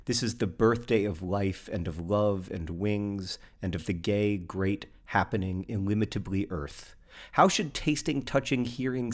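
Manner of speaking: gentle, slowing poem recital; poetry reading. Acted. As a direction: read the poem with quiet wonder. Reads a poem aloud.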